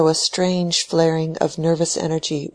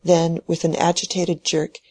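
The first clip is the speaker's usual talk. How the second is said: usual speaking voice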